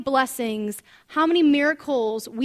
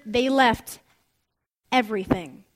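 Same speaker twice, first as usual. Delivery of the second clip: long pause before "everything"; pointed emphasis; 4 semitones lower than usual. Shown as a demonstration. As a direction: stop after "left", then drop lower on "everything". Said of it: "Did you catch that word?".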